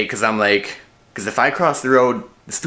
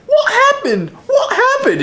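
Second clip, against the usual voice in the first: squeaky, much higher British voice with a laugh; 4 dB louder than usual. Retold or imitated. Vlogger mimicking the kid waking up, squeaky British. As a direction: laugh through a squeaky high British accent. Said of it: "he turns British in a high squeaky voice".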